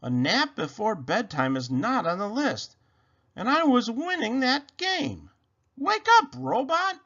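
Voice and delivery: protesting rabbit. A narrator's reading. Rabbit tries waking sleeping Robot, protest rising high.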